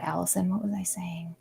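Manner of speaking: quick whispered aside to her co-host; hushed plea for help. Real, not acted. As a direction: drop to a whisper, asking for help.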